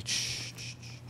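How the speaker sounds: soft unvoiced shushing. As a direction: hush a frightened pet. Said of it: "Je la réconforte".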